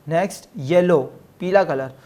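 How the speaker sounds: usual speaking voice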